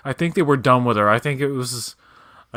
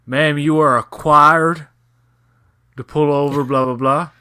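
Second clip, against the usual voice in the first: slow, loud Southern Florida cop drawl; a stern Florida cop reading her the rules; Southern cop impression; 4 semitones higher, 7 dB louder than usual. Talking to a driver he pulled over, loud slow Southern cop. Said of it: "did an impression of a cop down in Florida".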